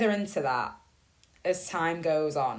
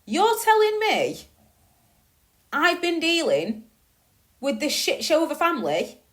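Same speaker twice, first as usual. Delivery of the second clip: outraged disbelief; 4 semitones higher, 3 dB louder than usual. Real, not acted. Venting to viewers about hidden siblings, outraged and higher. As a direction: let the outrage spill over.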